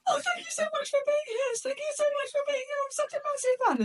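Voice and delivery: very high, gushing fan squeal on the edge of tears; squealing like a banshee. Retold or imitated. Meeting Barbara at the car, squealing like a banshee. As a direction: gush at your idol in a high squeal. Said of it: "almost squealing like a banshee".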